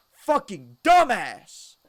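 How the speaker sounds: high, loud, slow exasperated shout; exasperated yell. Retold or imitated. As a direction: yell it at the idiot friend. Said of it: "I said it out loud".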